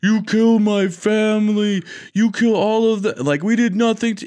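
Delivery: mock-tearful monster pleading; sobbing Bigfoot. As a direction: whimper it out like a grieving Bigfoot. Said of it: "he's sobbing ... he's like".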